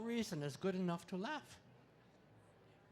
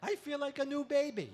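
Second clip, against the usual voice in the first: put-on new baby voice; 9 semitones higher, 6 dB louder than usual. Joke about reincarnation.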